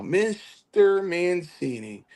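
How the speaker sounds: high, very drawn-out name call; Chief. Retold or imitated. Teller hearing Chief in memory, imitated long high call. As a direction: stretch each syllable of the name up high. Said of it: "I can hear him say it now".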